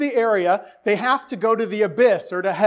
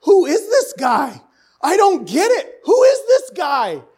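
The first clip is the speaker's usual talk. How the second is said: higher, louder exasperated Who is this guy; a mock bewildered outburst, raised and loud; baffled disciples; 9 semitones higher, 6 dB louder than usual. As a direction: play the confused disciples throwing up their hands. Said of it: "the disciples are like".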